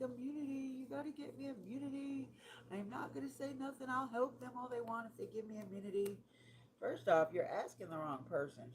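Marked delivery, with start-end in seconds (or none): 0.0-6.2 high-pitched mock pleading; imitated begging. Retelling a woman's phone plea for immunity, mock begging. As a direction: plead it in a raised voice, mimicking her. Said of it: "she was begging for immunity".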